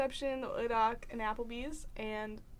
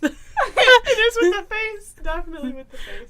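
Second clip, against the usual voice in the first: laughing; 9 semitones higher, 9 dB louder than usual. Real, not acted. Friends tease sexy voice attempt.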